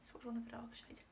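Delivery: whispered to herself; whispered note while swatching; whispered aside. Real, not acted. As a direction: murmur it under your breath.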